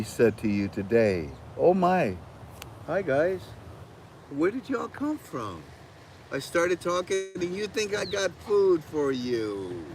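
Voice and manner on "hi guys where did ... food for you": talking to birds. Real, not acted.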